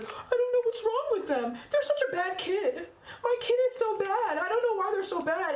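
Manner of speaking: high-pitched, whiny imitation of a parent; mock complaining parent. Parent complaining about their bad kid, mocking high whine.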